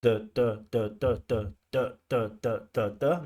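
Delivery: imitating choppy even stress on every the; the, the, the with every syllable stressed the same; mock flat rhythm. Shown as a demonstration. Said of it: "the candidate speech is sometimes like this".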